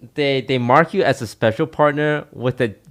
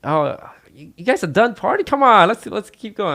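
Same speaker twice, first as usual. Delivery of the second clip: much higher, whiny come-on; pleading party bro; 9 semitones higher than usual. Retold or imitated. Tarek begging Toast to keep partying, high pleading bro voice. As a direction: beg him not to end the night.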